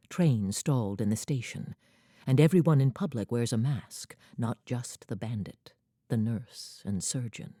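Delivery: normal talking voice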